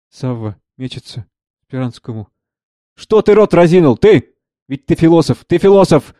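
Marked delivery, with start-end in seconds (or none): 2.9-6.1 higher-pitched, acted exasperation; agitated demand. Savva rounds on the philosopher Speransky, agitated. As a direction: round on a gaping listener.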